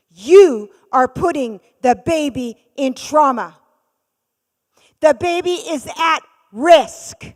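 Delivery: loud, slow, high urgent warning; urgent nurse warning. Retold or imitated. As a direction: make her hear the danger to the baby. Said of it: "and she goes".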